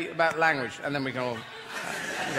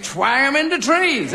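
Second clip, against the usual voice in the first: cheerful motto; 7 semitones higher, 6 dB louder, slower than usual. Acted. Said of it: "that's what I say".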